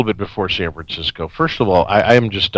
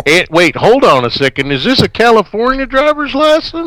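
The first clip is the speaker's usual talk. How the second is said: Southern cop; 13 semitones higher than usual. Pretend. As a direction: shout it like a shocked sheriff. Playing a suspicious cop.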